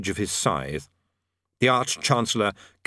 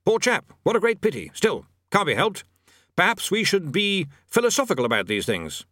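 flustered; 7 semitones higher than usual. A narrator's reading. Bursar eager to end.

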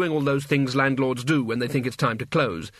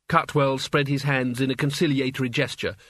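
ordinary delivery

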